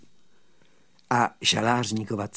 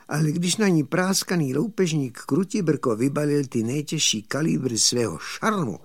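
plain reading voice

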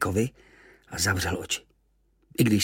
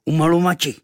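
war cry; 7 semitones higher, 7 dB louder than usual. Acted. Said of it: "vyřkl zase".

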